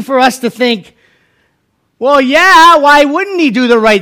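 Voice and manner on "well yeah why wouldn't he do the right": high, loud, slowing rhetorical question; imitating a breezy assumption, pitched up; mock obviousness. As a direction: say it as if it's obvious. Voices congregation's easy assumption.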